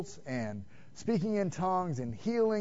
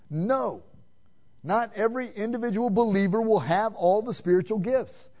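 ordinary talk

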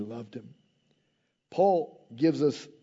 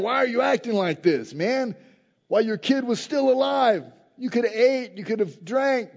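incredulous attendants; 7 semitones higher than usual. Retold or imitated. Attendants mocking David, voiced.

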